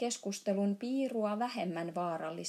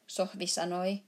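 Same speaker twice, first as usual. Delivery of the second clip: ordinary delivery, nothing marked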